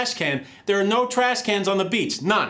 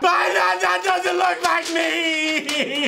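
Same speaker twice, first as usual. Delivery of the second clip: furious shout; 12 semitones higher, slower than usual. Acted. TV host screaming at a second grader over her drawing. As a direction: lose it completely and scream.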